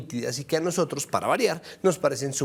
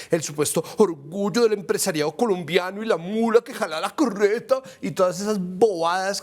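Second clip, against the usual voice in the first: mock-heroic tone, pitched up; sarcastic, higher-pitched parody of boosterism; mocking grandiosity; 4 semitones higher than usual. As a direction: puff up the praise with scornful pomp. Mocks praise of business group.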